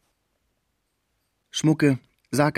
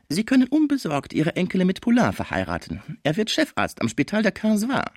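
much higher-pitched character voice; schrecklicher Fraisier; 11 semitones higher than usual. Acted. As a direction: play the dreadful lawyer, pitched high. (schrecklicher Anwalt, hohe Stimme)

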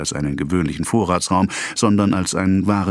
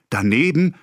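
higher, alarmed question; startled cry; 8 semitones higher than usual. A narrator's reading. Wife startled by the danger, higher and alarmed. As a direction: gasp it out. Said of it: "rief Madame Homais erschrocken".

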